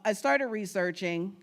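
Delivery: usual speaking voice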